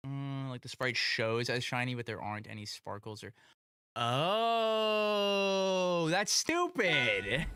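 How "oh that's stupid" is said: exasperated groan. Real, not acted. Reacting to missing sparkles.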